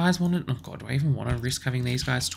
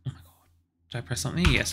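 ordinary talk, nothing marked

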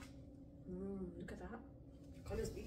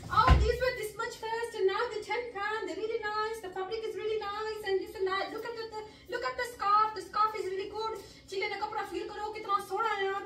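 high, eager sales pitch; saleswoman voice; 9 semitones higher than usual. Retold or imitated. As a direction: imitate a pushy shop lady selling. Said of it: "and she goes".

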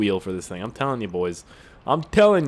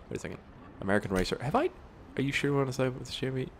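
ordinary talk, nothing marked

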